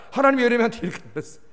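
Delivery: ordinary talk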